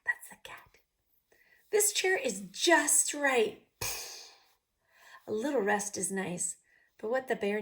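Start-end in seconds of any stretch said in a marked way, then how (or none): 0.0-0.6 whisper. Real, not acted. Whispered aside. 1.7-3.6 content sigh. Acted. Bear finds right chair.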